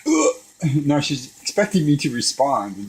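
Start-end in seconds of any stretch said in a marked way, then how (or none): 0.1-0.4 choked gagging noise; wordless retching grunt, re-enacted; imitated gagging. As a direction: act out a throat closing up.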